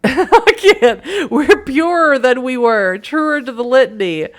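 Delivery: high, loud, slow giggling recitation; laughing too hard to say it seriously; laughing through a line. Real, not acted. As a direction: try to intone the litany but crack up laughing. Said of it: "I can't say that. That's too silly".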